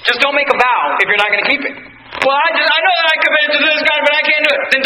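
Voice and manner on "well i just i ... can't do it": high-pitched, hurried excuse; whining excuse-maker. Acted. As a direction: plead your way out of a promise. Voices a promise-breaker's excuse to God.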